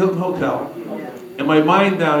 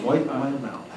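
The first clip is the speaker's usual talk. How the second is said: softer, dropped-voice echo of the order; quiet repeat; 3 semitones lower, 9 dB quieter, slower than usual. Retold or imitated. Scared guest echoing Mary's order, quiet aside.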